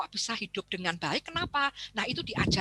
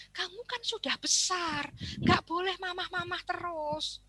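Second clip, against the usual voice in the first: high, slow scolding adult; lecturing adult; 8 semitones higher, slower than usual. Retold or imitated. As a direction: lecture the child slowly. People lecture the child.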